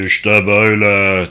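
low, slow put-on voice; mock-deep booming demonstration; deep trumpet-like voice. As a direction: thicken the voice and draw it out. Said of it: "borazan gibi kalınlaştırarak".

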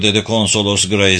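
ordinary delivery, nothing marked

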